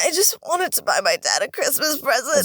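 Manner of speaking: lightly voiced pleading puppet; Pinocchio. Pinocchio excuses himself to the fairy, lightly put-on pleading voice. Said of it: "Pinocchio's like".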